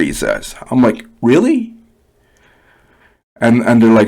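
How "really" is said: high, rising incredulous question; retold disbelief. Teen answers an insulting dad, retold high disbelief. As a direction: replay your own disbelief, pitched right up. Acts out own disbelieving reply.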